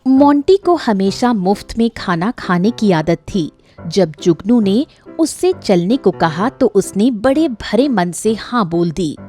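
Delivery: usual speaking voice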